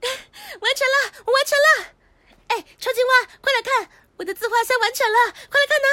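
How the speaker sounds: loud, triumphant announcement; excited calling out. Acted. Girl showing off her finished self-portrait, calling excitedly. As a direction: call them over at full voice.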